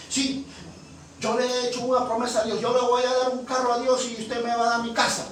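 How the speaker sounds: high, eager acted pitch; insistent bargaining. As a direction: push the deal on the pastor. Acts out woman pitching a deal.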